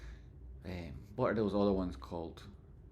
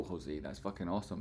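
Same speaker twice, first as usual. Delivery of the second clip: ordinary talk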